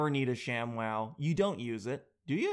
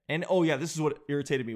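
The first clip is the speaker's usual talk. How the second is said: normal talking voice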